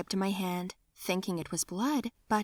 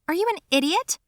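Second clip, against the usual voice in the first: incredulous; 10 semitones higher, 6 dB louder, faster than usual. Retold or imitated. Incredulous retort to Derek.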